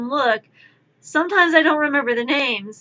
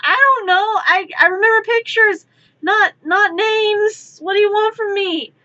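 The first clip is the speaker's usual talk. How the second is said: higher and louder, defensively exasperated; imitating myself cornered; mock helpless protest; 9 semitones higher, 4 dB louder than usual. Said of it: "I'm like".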